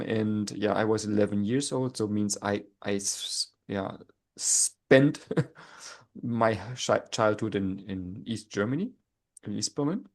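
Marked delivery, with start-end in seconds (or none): none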